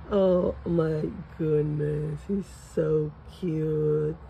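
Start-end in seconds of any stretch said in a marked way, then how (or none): none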